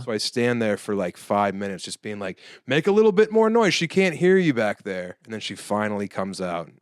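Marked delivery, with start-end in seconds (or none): 2.7-5.2 high, loud emcee hype; hype the crowd. Retold or imitated. Stalling emcee begs the crowd to cheer: high loud hype. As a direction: pump up the room while stalling.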